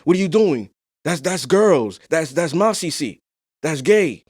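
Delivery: imitating an old school Haitian dad scolding his son; lightly voiced strict Haitian father. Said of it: "Old school Haitian".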